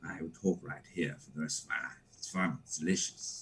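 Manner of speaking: soft, low posh British voice; posh British accent. Shown as a demonstration. Performer picking an impression for life, hushed posh British. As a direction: purr it quietly in posh British. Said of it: "like, a posh".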